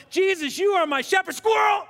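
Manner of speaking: loud, high, gushing dog blurt; mock distracted dog. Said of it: "where we're going".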